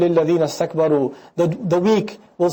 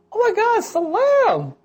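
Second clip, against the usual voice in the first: much higher, gushing "Oh my God, salam"; excited greeting; 14 semitones higher, slower than usual. Acted. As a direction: greet an old friend with delight.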